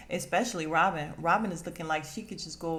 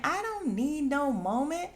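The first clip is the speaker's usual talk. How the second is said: higher put-on defensive voice; lightly voiced, higher indignant Robin; mock denial; 8 semitones higher than usual. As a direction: brush it off in a put-on voice. Robin mocked, denying.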